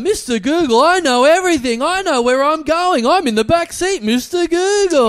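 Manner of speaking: high, loud, slow mocking voice; high slow mock Mister Google; mock driver voice. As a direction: ham up the driver squealing the name.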